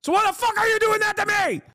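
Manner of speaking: fake-outraged yell at chat; mock rage. Streamer pretending to blow up at chat, hammed-up shout. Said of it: "Anyway, I'm not actually mad. I'm memeing".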